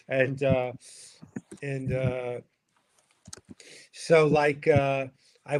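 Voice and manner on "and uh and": usual speaking voice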